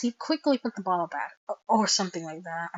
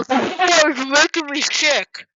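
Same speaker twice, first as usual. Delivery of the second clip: disgusted character voice; 6 semitones higher, 8 dB louder, slower than usual. Acted.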